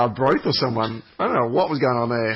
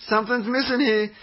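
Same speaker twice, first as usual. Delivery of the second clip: emphatic; 10 semitones higher than usual. Shown as a demonstration. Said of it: "but you know, something's missing".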